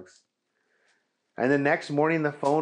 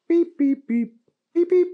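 high squeaky beeps; phone beeps; 7 dB louder than usual. Acted. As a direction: chirp like a phone keypad. Phone dialing beeps imitated.

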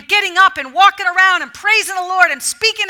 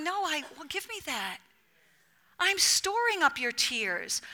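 much softer, intimate aside; hushed Jesus; 10 dB quieter than usual. Acted. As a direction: drop to a hush, acting out a gentle request. Voicing Jesus gathering up tears.

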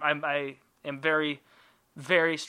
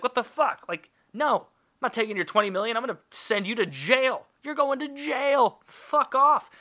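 mock outrage; 9 semitones higher than usual. Victim refuses the money, much higher outraged yell. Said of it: "I'm gonna be like".

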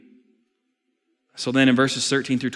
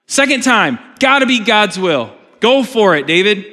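rising, louder, pressing servants egging David on; urging David on; 4 semitones higher, 5 dB louder than usual. Acted. Preacher voicing servants urging David to kill Saul, building to a shout. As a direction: build it higher and louder to the shouted "Second time".

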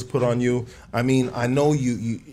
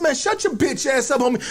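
high, mock-angry yell back; retold yelling match; 12 semitones higher than usual. Man retelling his reply in a yelling match, mock yelling. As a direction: fire back in a raised, high-pitched voice. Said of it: "now I got in a yelling match".

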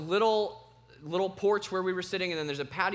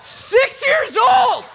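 very high, drawn-out full-force yell; hammed-up fatherly outrage at top volume; outraged shout; 15 dB louder, much slower than usual. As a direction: scream it like an appalled dad, stretching every word. Outraged father reacting in his story.

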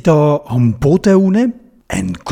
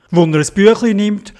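plain reading voice

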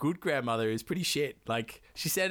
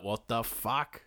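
mock angry dad; much slower than usual. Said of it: "Oh, he's angry".

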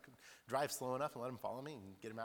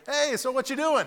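eager neighbor call; 13 semitones higher, 13 dB louder than usual. Retold or imitated. Neighbor calling from porch.

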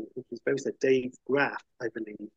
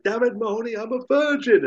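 high, blurted confession; a retold loud outburst, pitched up; mock loud blurt; blurting loudly; 10 semitones higher than usual. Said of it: "He says really loudly".

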